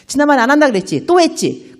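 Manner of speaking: imitating a nagging spouse, quick and high; mock nagging. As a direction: nag like a spouse keeping score. Husband imagines nagging his wife.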